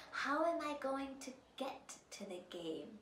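soft unvoiced whisper. Ellie to godmother, whispering. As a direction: ask it in a hush.

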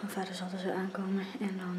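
usual speaking voice

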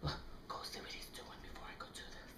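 very soft, quick whisper; hurried conspiratorial whisper; whispered instruction. Real, not acted. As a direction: send them off in a fast whisper. Secret plotting, he is inside.

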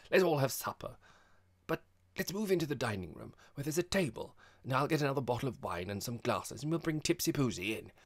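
rally everyone to supper; bright hospitable chatter. A narrator's reading. Host sharing the joke invites girls to supper, eagerly.

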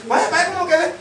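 mock posing guy. Muscle guy posing again.

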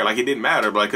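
usual speaking voice

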